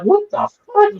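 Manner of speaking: incredulous, drawn out and high; retold disbelief. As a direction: stretch it out in bewildered disbelief.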